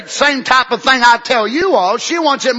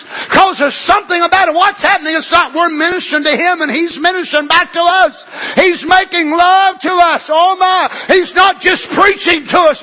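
preaching at full force; 7 semitones higher than usual. Acted. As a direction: preach it hard to the congregation.

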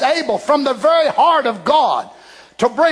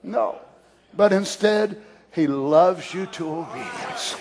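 hushed tender; 6 semitones lower, 6 dB quieter than usual. Acted. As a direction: drop to an intimate hush. Soft answer after loud take.

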